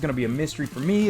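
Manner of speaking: ordinary talk, nothing marked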